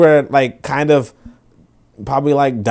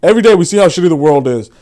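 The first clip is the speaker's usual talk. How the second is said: mock agreeing fan; 7 semitones higher, 5 dB louder than usual. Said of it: "and be like".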